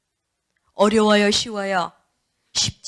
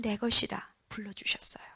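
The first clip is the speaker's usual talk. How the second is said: hushed scripture; 5 semitones lower, 8 dB quieter than usual. Real, not acted. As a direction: whisper it softly, almost breathless. 성경 구절을 속삭이며 읽음. (속삭이듯 낮게 읊는 말씀)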